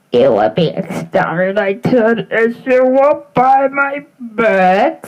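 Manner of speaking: slow, loud robotic threat through a filter; Dalek voice. Pretend. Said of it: "sound like a Dalek".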